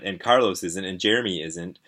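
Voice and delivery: ordinary delivery, nothing marked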